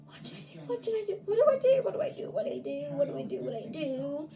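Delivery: imitated panicky babble, starting hushed, then high; freaking out. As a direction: replay a little kid's panic, repeating it frantically. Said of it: "I was, like, freaking out so much".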